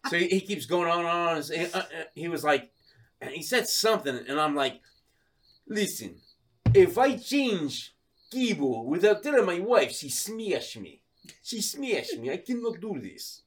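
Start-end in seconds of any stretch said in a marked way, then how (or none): 5.7-13.4 slow, deliberate mock Russian; Russian accent. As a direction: plead your case as a put-on Russian husband. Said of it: "this Russian guy".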